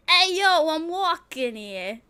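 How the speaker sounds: put-on loud, high New York–Boston street accent. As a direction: shout like a Boston cabbie. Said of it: "I think it is Boston".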